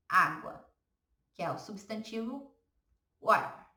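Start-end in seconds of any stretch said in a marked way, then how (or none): none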